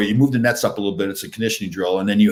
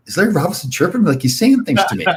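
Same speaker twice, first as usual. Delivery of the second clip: incredulous; 9 semitones higher than usual. Retold or imitated. Incredulous thought about Larry chirping.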